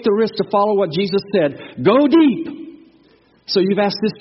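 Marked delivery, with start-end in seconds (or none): 1.8-2.5 higher, louder ringing command; forceful exhortation. Acted. Preacher urges the church to take risks, loud command.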